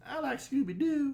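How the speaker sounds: high, childish mock voice of a little boy; kid voice. Teasing friend about his childhood, in a high kiddie voice. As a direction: squeak it like a small kid. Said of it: "you're like".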